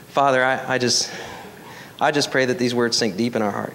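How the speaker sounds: low, hushed praying voice; prayer. Real, not acted. Pastor praying for his congregation, low prayer. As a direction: speak humbly and intimately to God.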